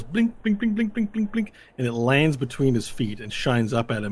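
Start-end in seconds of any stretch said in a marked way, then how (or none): 0.0-1.5 high, light, repeated object noise; pinging little sound effect; bouncing clinks. Retold or imitated. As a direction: imitate a small thing tinkling as it tumbles. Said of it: "it sort of goes".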